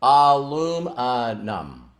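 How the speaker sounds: careful syllable-by-syllable "Aluminum"; slow syllables. Retold or imitated. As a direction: sound out "Aluminum" like a first try. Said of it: "say each syllable slowly".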